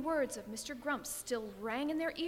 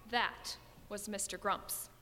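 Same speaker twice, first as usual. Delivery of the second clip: ordinary delivery, nothing marked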